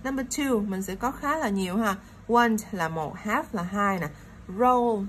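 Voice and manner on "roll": "Roll" punched out loud; stress on ROLL. Shown as a demonstration. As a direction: hit "Roll" hard as the stressed first syllable of robot. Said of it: "nhấn ở chữ roll". (nhấn mạnh, nói to chữ "Roll")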